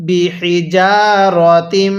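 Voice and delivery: বিহিজারাতিম drawn out slowly with nasal ghunnah, single j; correct Quran recitation. Shown as a demonstration. Teacher showing students the correct recitation, slow and nasal. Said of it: "এরকমভাবে পড়তে হবে".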